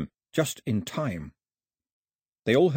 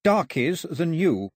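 slow, high, reluctant word; drawn out with effort; forced out; 6 semitones higher, slower than usual. A narrator's reading. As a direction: force the word out against your will. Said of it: "she forced the word out".

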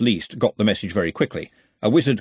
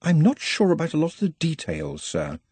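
concentrated; 7 semitones higher than usual. A narrator's reading. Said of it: "Nut's reply was concentrated".

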